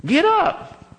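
high, forceful command; commanding God. Retold or imitated. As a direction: bark it as God. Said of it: "It's my translation".

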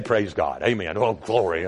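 put-on low old preacher praise; lightly imitating old pastor. Preacher recalling how his pastor prayed, low voice. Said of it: "I just, just say".

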